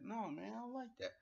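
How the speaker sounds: high-pitched mock protest; Enzo protesting. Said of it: "He's like".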